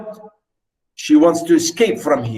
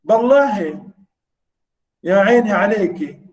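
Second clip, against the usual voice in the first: mock sweet husband; 4 semitones higher than usual. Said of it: "And he said to her".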